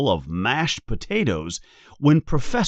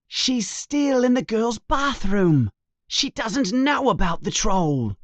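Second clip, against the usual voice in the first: put-on higher, anxious boy voice; urgent worry; 10 semitones higher than usual. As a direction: press the danger on Ron. Harry explaining urgently.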